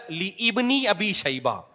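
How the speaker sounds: "لی ابن" split slowly as li IB-ni; imitating a false correction. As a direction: stretch "لی ابن ابی شیبہ" out as "li ibni abi shayba". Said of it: "غلط پڑھتے ہیں".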